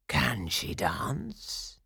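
slow, drawn-out menacing question; wicked pirate chief. A narrator's reading. Pirate chief asking his men about the captive, slow and menacing. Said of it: "he asked his men".

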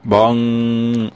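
long sung bong like a news chime. Pretend. Bonging the headline chime for the news feature. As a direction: ring out the headline gong.

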